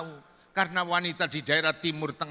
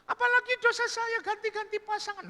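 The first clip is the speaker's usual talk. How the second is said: high falsetto mock weeping; crying woman. Woman crying over her sins, mock weeping. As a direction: wail repentantly in a high falsetto. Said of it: "wanita pelacur itu menangis".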